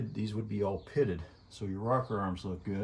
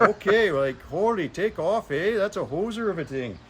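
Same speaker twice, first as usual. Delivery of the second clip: hammed-up Canadian accent, higher and louder; mock Canadian accent; 7 semitones higher, 6 dB louder than usual. Mechanic hamming a Canadian accent for the crew. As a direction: play the hoser Canadian for laughs. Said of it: "I'm gonna bring the full Canadian accent for you boys".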